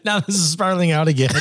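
usual speaking voice

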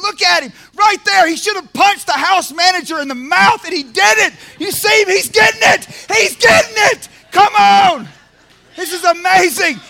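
loud, high, fired-up shouts; excited cheering. Acted. God cheering on a man in treatment who held his temper, shouting. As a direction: cheer him on like he won the game.

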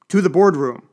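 loud, high decisive call; rally the staff forward; crying out. Acted. Ambassador leads the panicked staff, loud call. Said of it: "he cried".

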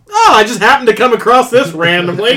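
lightly acted lucky find, hammed-up at the end; Howard Stark. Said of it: "Howard Stark was just like".

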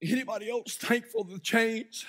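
closing revival prayer over music; altar prayer. Acted. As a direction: lead the congregation gently in prayer.

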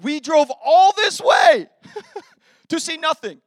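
loud, slow, laughing disbelief; exasperated complaint. Retold or imitated. Husband tells wife they drove all this way for nothing, loud and laughing. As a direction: groan it out, half laughing at the wasted drive. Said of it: "I was like".

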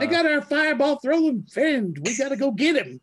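high-pitched, excitable squeaky character; squeaky yell. Acted. Dean rallies the party after the culprit, high squeaky yell. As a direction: holler it in a squeaky voice. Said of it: "this squeaky voice yelling".

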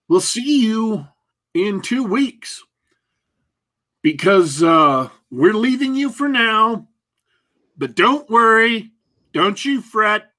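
high, drawn-out spooky farewell; ominous sign-off. Acted. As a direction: tease the return like a horror trailer. Dramatic show sign-off.

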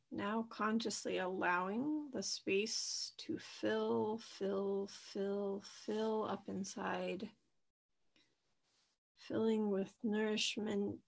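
soft, unhurried meditation voice with long pauses; guided meditation. Acted. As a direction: gently guide listeners into their breath, leaving space between phrases. Leads a guided breathing meditation.

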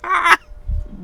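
squeaky burst of laughter; high giggle. Real, not acted. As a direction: crack up laughing. Laughing again at quote.

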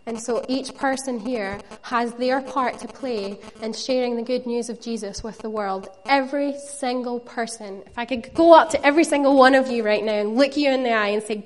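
ordinary delivery

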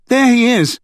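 sudden high call; a quick high call of discovery; calling out suddenly. A narrator's reading. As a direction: call out, pointing at him. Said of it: "Sarah called suddenly".

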